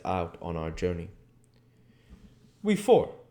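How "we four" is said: much higher, loud questioning; surprised question. A narrator's reading. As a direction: ask it in disbelief. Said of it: "said Harker interrogatively".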